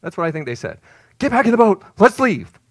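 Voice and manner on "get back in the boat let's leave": high, loud panicked order; frantic shouted command to the crew; urgent shout. Acted. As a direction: yell it like a man desperate to flee. Disciples panicking at the running madman.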